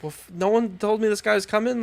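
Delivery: high-pitched, flustered objection; indignant protest. Retold or imitated. Guy retelling his reply to dad about the tree guy, indignant.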